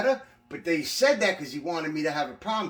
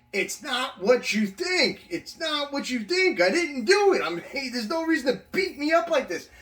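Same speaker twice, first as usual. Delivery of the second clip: choked pleading; 9 semitones higher, 4 dB louder than usual. Retold or imitated. Inmate begs guards while sergeant chokes him, strained choked plea. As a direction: plead while being choked, barely getting words out. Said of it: "I kept trying to talk like".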